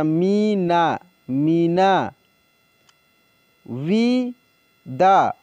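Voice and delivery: विदा drawn out very slowly, sound by sound; slow dictation of विदा; slow spelled-out reading. Shown as a demonstration. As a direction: stretch विदा for the class. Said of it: "teacher reads word slowly".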